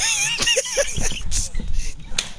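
shrill helpless laugh; cry-laughing squeal; laughing till it hurts; high squealing laugh. Real, not acted. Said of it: "You about to make me cry again".

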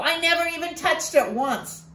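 put-on high child's denial; boy Ko lying to Tio, high and defensive; defensive little boy. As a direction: insist like a caught kid. Ko denies eating poi.

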